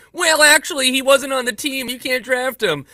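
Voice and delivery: high-pitched know-it-all impersonation; Matt Doyle. Retold or imitated. Host mimics a pundit disputing his draft win, high mocking impression. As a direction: push the voice way up, mocking a pedant's objection. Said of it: "That was my Matt Doyle impersonation".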